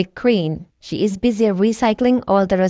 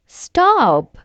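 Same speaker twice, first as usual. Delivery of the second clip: shout; 7 semitones higher than usual. A narrator's reading. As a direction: yell it to save the car. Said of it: "shouts Peppa".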